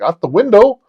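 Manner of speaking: high, loud rising question; incredulous echo. Retold or imitated. Man ordered out by his lover, high incredulous echo. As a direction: repeat it in mock alarm. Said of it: "I'm like".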